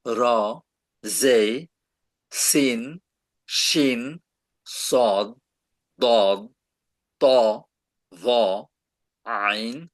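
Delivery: reciting the Arabic letters one by one with pauses; name each letter clearly, like a teacher; alphabet recitation. Shown as a demonstration. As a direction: recite the alphabet for a student who asked. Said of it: "অক্ষর, মামা".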